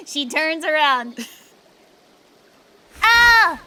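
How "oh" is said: loud wailing boo-hoo sobs, slow; overacted sobbing to win sympathy; loud mock blubbering; hammed-up fake crying. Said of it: "I'm not a great actor".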